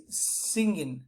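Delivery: "singing" low and soft with a nasal ng and no hard g. Shown as a demonstration. Teacher modelling "singing" with nasal ng. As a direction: let "singing" end on a ringing ng. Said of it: "it is like this".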